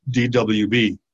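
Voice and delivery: ordinary talk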